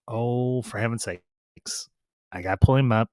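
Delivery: usual speaking voice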